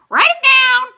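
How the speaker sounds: very high, loud squawk; raven voice. A narrator's reading. Blue Sky the raven squawking at the table. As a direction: screech like a raven. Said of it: "said Blue Sky".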